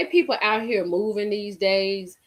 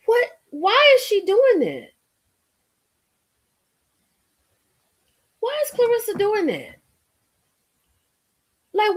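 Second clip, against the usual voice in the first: exasperated; 10 semitones higher than usual. Real, not acted. Exasperated high-pitched complaint.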